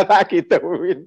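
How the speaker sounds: slow words broken by real laughter; laughing. Real, not acted. Speaker laughing at how he answered the man, slow and amused. Laughing at his own retort.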